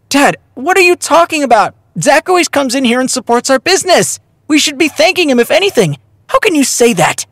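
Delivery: high-pitched, indignant daughter; scolding. A narrator's reading. Daughter defends Zach to her father, scolding. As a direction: tell Dad off in protest. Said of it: "she scolded the middle-aged chef".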